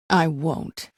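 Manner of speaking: normal talking voice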